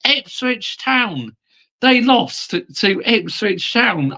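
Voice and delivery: high, drawn-out disbelief; incredulous. Real, not acted. Gloating disbelief at rival's loss.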